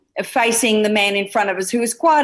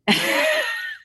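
high laugh. Real, not acted. Mother laughing.

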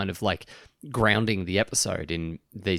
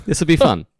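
sarcastic; 8 semitones higher, 8 dB louder than usual. Real, not acted. Repeats the sarcastic remark.

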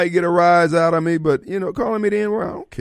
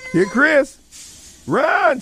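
calling a cat; 8 semitones higher, much slower than usual. Pretend. Mock chaos with cat.

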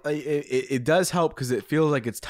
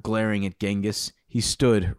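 ordinary talk, nothing marked

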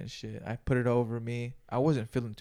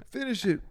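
high gasp; 10 semitones higher than usual. Retold or imitated. Trying to finish the dab, gasping high. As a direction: gasp high then push out the words.

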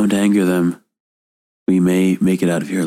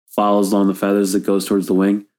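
ordinary talk

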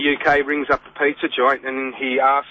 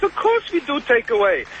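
higher-pitched imitated Irish accent; 9 semitones higher than usual. Caller retells pizza man's takeaway reply, imitated Irish accent. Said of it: "in an Irish accent".

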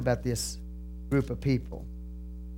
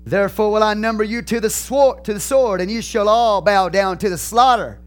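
loud, higher, ringing pulpit reading; preaching at full force; 5 semitones higher, 5 dB louder than usual. Acted. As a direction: thunder the verses out to the congregation.

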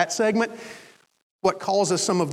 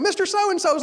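frantic; 11 semitones higher than usual. Retold or imitated. Doctor exclaims.

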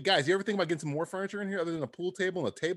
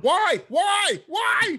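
higher, louder repeated why, hammed-up; mock outrage; 12 semitones higher, 4 dB louder, much slower than usual. Upset customer shouting over late package, hammed-up. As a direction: wail it like a wronged customer.

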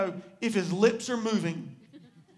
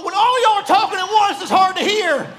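loud, high-pitched shout; raised voice, high and very loud; shouting over chatter; 6 semitones higher, 11 dB louder than usual. Real, not acted. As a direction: yell over a crowd all talking at once. Calling over a noisy crowd talking.

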